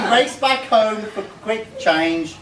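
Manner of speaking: ordinary talk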